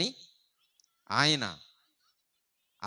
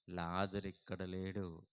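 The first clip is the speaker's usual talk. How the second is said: hushed; 7 semitones lower, 9 dB quieter, slower than usual. Acted. Hushed dramatic turn.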